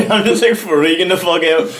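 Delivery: ordinary talk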